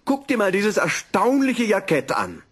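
high, loud excited professor; eager showing off. Acted. Professor eagerly shows his wife the jacket, high and excited. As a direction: burst in to show it off.

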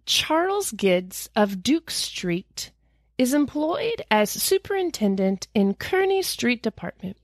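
ordinary talk, nothing marked

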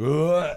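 loud retching gag noise; mock gag. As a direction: gag as if something hit the throat. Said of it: "I'd be like".

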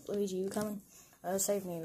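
ordinary talk, nothing marked

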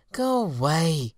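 high, slow, drawn-out call; sleepy fairy's call. Acted. Fairy in bed calling to the moths, high and slow. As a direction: shoo them away from bed. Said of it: "she called from her bed".

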